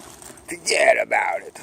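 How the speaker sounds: slow Brooklyn accent. Shown as a demonstration. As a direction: stretch it like a Brooklyn local.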